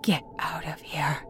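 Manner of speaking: shaky terrified whisper; trembling whisper. Acted. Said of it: "albeit as a trembling whisper".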